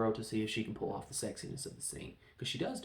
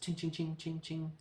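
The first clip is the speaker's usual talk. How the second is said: quick, high-pitched ching sounds; imitated sword clinks; 6 semitones higher than usual. Reviewer acting out the fencing match, imitated blade clinks.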